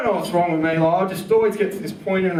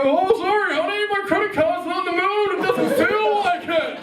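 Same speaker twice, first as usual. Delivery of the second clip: high, mocking sing-song excuse; mock high punchline; mock refusal; 9 semitones higher than usual. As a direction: throw her line back in a high voice.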